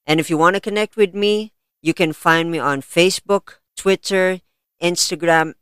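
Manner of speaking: podcast host intro. Acted. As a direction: announce it like a show host. Host opening announcement.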